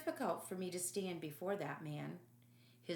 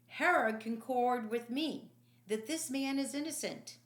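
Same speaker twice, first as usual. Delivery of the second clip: announcing; 5 semitones higher, 6 dB louder than usual. Acted. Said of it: "Pilate announced".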